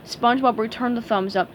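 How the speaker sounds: usual speaking voice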